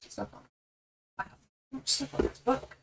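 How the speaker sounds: talking through a mouthful of cereal; eating cereal. Real, not acted. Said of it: "let me finish my cereal".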